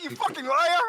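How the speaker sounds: high, loud laughing accusation; accusing shout. Retold or imitated. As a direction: yell it at a friend in fun.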